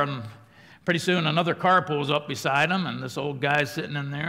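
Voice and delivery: ordinary talk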